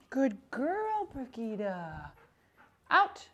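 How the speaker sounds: high sing-song praise and cues for the dog. Real, not acted. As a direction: gush over the pup, then chirp the cue. Trainer praising dog during drill.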